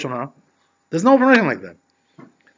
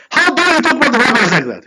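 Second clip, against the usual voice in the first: mock outrage; 9 semitones higher, 6 dB louder than usual. Vigilante hits the critic.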